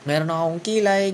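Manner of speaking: playful gratitude. Pretend. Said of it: "I-recognize mo".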